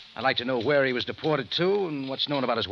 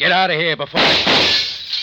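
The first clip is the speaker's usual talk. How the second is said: slow furious yell, much louder and higher; angry shout; 7 semitones higher, 10 dB louder, slower than usual. Acted. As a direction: bellow at the intruder to get out. Angry man orders intruder out.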